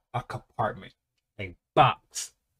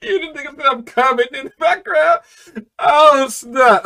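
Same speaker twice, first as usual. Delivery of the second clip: high-pitched cracking up; laughing to tears; 12 semitones higher, 6 dB louder than usual. Real, not acted. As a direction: squeal it out, nearly crying with laughter. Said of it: "I'm already about to start tears".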